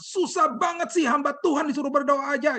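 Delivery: imitating a high, exasperated outburst; acted high-pitched angry wail; tearful yell. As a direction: mimic someone yelling through tears, pitched very high. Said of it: "sambil berteriak, dia bilang".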